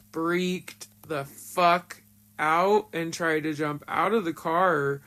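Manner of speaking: ordinary delivery, nothing marked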